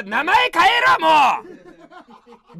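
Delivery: sudden loud barked order, voice jumping up; yelled retort. Real, not acted. Streamer yelling at a superchatter to change their name. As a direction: shout it at the viewer like a scolding.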